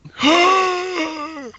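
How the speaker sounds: big mock gasp of horror; sharp high shocked gasp; theatrical gasp. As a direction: gasp like you've seen something awful.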